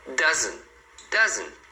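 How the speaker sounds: doesn't as "dazn"; dropped final T. Shown as a demonstration. Teacher showing what you'll hear, doesn't without T. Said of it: "muito sem o T".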